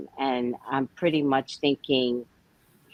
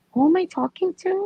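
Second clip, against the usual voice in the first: retold confusion; 13 semitones higher than usual. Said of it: "I was like".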